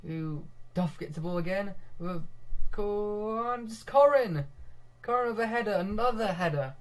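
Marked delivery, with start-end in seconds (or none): 2.7-6.7 loud, high cry on the scorer's name, easing down; excited goal call. Real, not acted. Commentator hailing another header, excited goal call. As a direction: shout the scorer's name as it lands.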